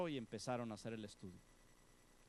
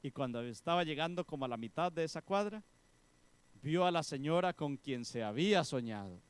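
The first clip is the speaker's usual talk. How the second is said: ordinary talk, nothing marked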